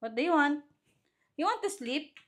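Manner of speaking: high-pitched mommy asking. Real, not acted. Mother asks fussy baby.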